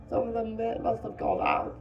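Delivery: put-on the fat boy, hoping for reassurance; fat boy, anxious. A narrator's reading.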